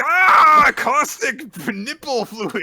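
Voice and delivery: high, loud and drawn out; dramatic shriek. Retold or imitated. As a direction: wail it like a horrified cry. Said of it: "It's like".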